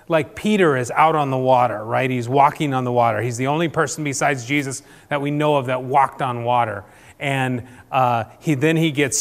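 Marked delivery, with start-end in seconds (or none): none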